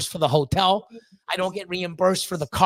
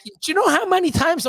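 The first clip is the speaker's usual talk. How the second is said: much higher, exasperated challenge; incredulous protest; 10 semitones higher, 3 dB louder than usual. Retold or imitated. Protesting to the customs agent, high exasperated question. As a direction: demand she see how ridiculous this is.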